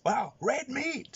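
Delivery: high, slow, drawn-out awe; high, slow impressed street voice; amazed gangster. Retold or imitated. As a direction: marvel at the shirt like a gangster.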